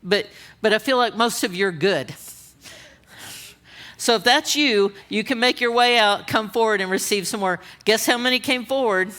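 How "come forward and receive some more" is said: usual speaking voice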